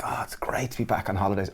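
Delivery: airy half-whispered exclamation; hushed mock delight. Twin getting off the plane in London, hushed delight. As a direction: sigh it out softly, as if just arrived.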